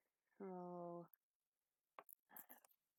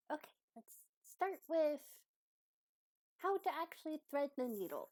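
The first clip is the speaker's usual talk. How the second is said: higher-pitched patient instructor; helpful teacher character; 8 semitones higher, 3 dB louder than usual. Acted. Abby starts teaching Croak to thread a needle, helpful. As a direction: begin the lesson in a raised, helpful voice.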